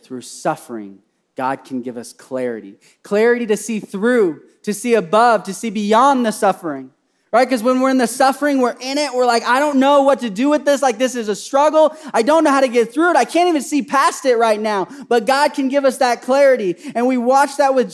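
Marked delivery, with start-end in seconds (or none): 3.0-9.4 loud, high, impassioned sermon; fervent preacher building to a cry; full-force preaching. Real, not acted. 9.4-14.9 overwhelmed. Acted. Acting out overwhelmed sufferers.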